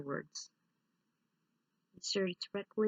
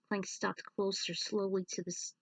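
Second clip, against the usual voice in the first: usual speaking voice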